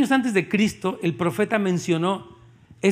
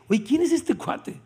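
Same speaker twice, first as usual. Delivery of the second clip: amazed; 6 semitones higher, slower than usual. Acted. Isaiah marvels at distant figure.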